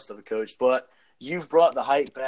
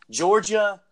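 frat bro; 5 semitones higher, 4 dB louder than usual. Real, not acted. Hyped shout-out to alma mater.

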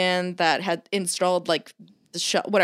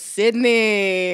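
a hint of the assistant's accent, louder; imitating assistant; 4 dB louder than usual. Quoting the silent assistant, a louder knowing reply. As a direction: say the name with sly recognition. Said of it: "looks at me and goes".

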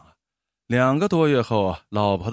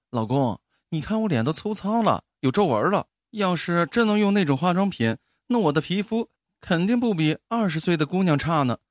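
higher-pitched voice of a wife; wistful wife; 6 semitones higher than usual. A narrator's reading. Wife longing for better skin, higher wistful voice. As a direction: plead a little wistfully to your husband. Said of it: "老婆的神色有些黯然".

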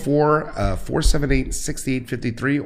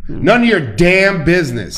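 louder, higher sassy retort; 7 semitones higher, 8 dB louder than usual. Real, not acted. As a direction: snap it back playfully. Punchline to voting question.

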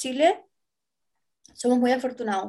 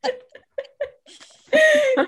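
laughing it out; words through laughter; 7 semitones higher, 5 dB louder, faster than usual. Real, not acted. Laughing at own story.

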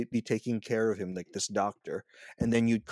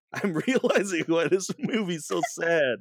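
higher and louder through a laugh; laughing realization; 8 semitones higher, 6 dB louder than usual. Retold or imitated. Recalling her own reaction to the movie, laughing. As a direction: laugh it out as it dawns on you. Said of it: "I was just like".

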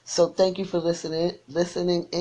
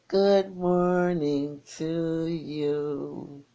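very slow drawn-out greeting; much slower than usual. Real, not acted. Radio host greeting a caller on air, very slow and drawn out. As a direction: stretch every word of good morning to you out long and lazy.

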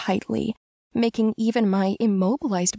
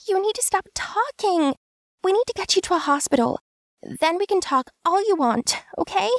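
high, fast female voice, hurried; urgent; 12 semitones higher, faster than usual. A narrator's reading. Tansu urging injured Kim to the hospital, hurried. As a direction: rush him, worried.

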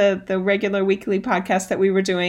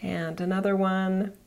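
guided meditation. Acted. Leads a breathing meditation.

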